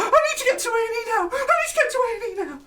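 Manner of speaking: very high tearful squeal, hammed up; exaggerated sobbing plea; mock hysterical wail. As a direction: imitate someone wailing in panic for help. Mocking contestant's wailing.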